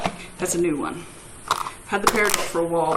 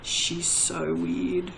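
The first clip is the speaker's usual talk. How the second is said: bemused viewers; 2 semitones lower, 7 dB quieter, much slower than usual. Acted. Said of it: "now yous are all going".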